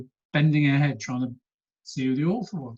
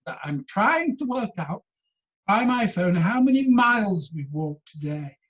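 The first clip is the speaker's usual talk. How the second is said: husband protesting; 5 semitones higher, 4 dB louder than usual. Retold or imitated. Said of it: "they had this row".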